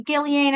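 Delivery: Ghislaine said as GILL-ee-ain; hard-g Ghislaine, GILL-ee-ain, s left silent; chosen pronunciation. Shown as a demonstration. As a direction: say Ghislaine with a hard g and three syllables. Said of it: "I'm gonna call her Ghislaine".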